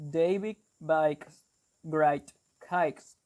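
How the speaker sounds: slow Brummie 'David bakes great cakes', 'ay' turned to 'eye'; Brummie accent. Shown as a demonstration. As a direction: demonstrate Brummie, saying BAKES like 'bikes'. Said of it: "instead of saying "a," they say".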